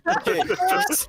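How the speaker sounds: laughing. Real, not acted. Flustered by racism joke.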